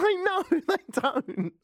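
high, slow, laughing no; laughing reply. Retold or imitated. Irish ambassador answering a naive politician, laughing high and slow. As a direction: answer with amused disbelief through a laugh. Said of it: "And they'll say".

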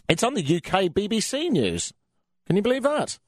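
playing up the shock for listeners; mock disbelief. Real, not acted. Radio host to listeners, in high mock astonishment. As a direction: pitch jumps way up in amazement.

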